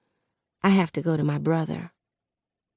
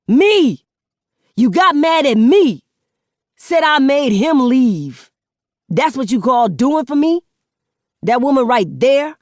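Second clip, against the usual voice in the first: much louder, higher yelling son; a son yelling his grievances at his mother; furious shout; 8 semitones higher, 6 dB louder than usual. Acted. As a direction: shout every line at her.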